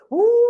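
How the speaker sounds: high, loud whooshing "woo"; wind howl. Acted. Storyteller as wind starting to blow, high howl. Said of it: "wind would start to blow".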